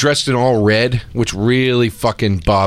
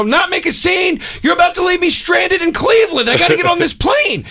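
shouting at agent. Retold or imitated. Angry traveller yelling at the airline agent in disbelief. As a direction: yell it back in disbelief.

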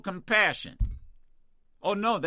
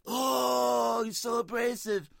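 mocking critics; 6 semitones higher, slower than usual. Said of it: "it's like".

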